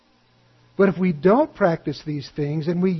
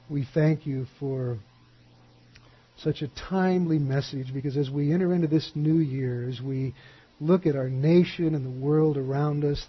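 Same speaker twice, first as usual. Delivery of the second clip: soft, quiet prayer voice; 4 semitones lower, 6 dB quieter than usual. Acted. Pastor closing the sermon praying for the new year, quiet and reverent. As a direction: speak reverently to God.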